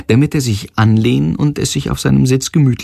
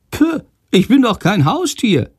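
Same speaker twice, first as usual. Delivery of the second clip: high squeaky bat grumble, much higher; a tiny offended bat, pitched way up; grumbling bat; 11 semitones higher than usual. Acted. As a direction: huff indignantly like a small bat. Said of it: "meckert Shandor".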